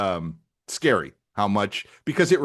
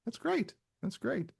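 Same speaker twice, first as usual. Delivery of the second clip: mock sweet; 13 semitones higher, 9 dB quieter than usual. Teasing tearful friend.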